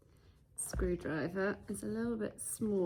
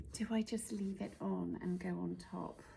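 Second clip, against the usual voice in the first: normal talking voice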